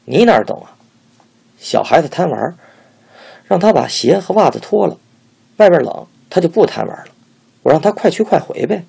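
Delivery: high, loud self-satisfied tone; smug explanation. A narrator's reading. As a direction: explain it smugly, pleased with herself. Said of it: "得意地说".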